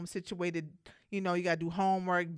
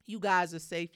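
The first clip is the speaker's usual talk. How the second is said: ordinary talk, nothing marked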